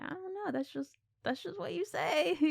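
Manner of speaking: soft, high-pitched imitation of nervous excuse; uneasy backpedalling. Projectionist excusing himself to Pearl, soft uneasy imitation. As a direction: back off in a small, high voice. Voicing man uneasily backing away.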